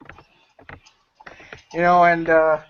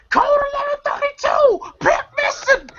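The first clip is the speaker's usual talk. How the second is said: mock alarm call.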